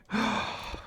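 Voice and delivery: hammed-up shocked gasp; mock gasp. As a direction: gasp as if scandalised.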